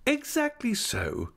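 a hint of a grand, fussing wife; Mrs Micawber voice. A narrator's reading.

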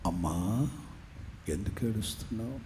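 low gentle voice of Jesus; Jesus asking gently. Acted. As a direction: ask her softly, like a comforting father. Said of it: "అసలు నీ దుఃఖం ఏంటి?".

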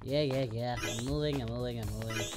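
ordinary delivery

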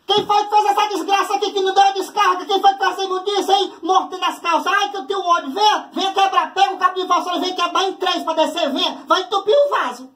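high, outraged mother ranting; furious mom at toilet. Retold or imitated. Mother ranting at the kids about the unflushed toilet, high and outraged. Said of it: "atitudes que ela tinha".

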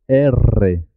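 long trilled rr in "R"; vibrating R. Shown as a demonstration. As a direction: say "R" as erre, tongue tip vibrating. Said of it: "vibrar el sonido".

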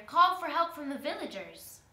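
loud, high, urgent plea; pleading. A narrator's reading. Trapped spider asks girl to fetch villagers, pleading loudly. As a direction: beg urgently, raising pitch and volume. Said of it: "pleaded the spider".

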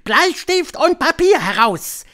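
high, loud, drawn-out witch voice; slow, high witch command; commanding witch leader. A narrator's reading. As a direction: order the coven in a high, imperious voice.

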